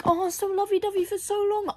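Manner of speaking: high, soft mocking coo; mock lovey-dovey. Reader mimicking the love interest, mock lovey-dovey. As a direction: put on a sugary high voice. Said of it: "he's been like".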